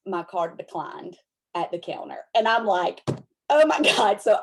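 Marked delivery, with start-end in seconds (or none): 3.5-4.2 laughing. Retold or imitated. Card declined, embarrassed laugh.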